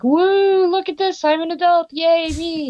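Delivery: high, giddy self-congratulation; a little cheer for herself; mock delight. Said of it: "I'm like".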